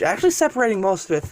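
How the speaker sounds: ordinary delivery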